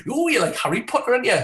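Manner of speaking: high, swooping mock excitement; lightly mocking fan voice; imitated gushing foreigner. Mimics foreigners' reaction to Britons.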